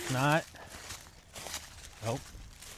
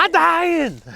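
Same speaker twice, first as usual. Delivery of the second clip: laughing; 9 dB louder than usual. Real, not acted. Laughing after the sneeze.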